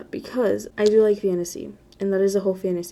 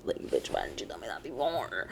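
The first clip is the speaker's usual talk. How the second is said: much lower and softer, under the breath; low muttered aside; 11 semitones lower, 11 dB quieter than usual. Real, not acted. Vlogger to absent friend, muttered under breath. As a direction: grumble it to yourself.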